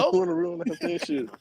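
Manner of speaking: high, mocking falsetto woman; mock girl voice. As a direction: squeak it like the girl. Repeating line in girl voice.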